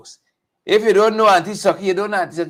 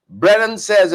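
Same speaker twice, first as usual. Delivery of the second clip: usual speaking voice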